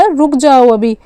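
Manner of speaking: usual speaking voice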